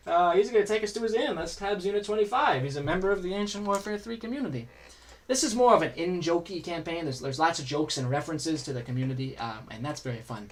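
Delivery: normal talking voice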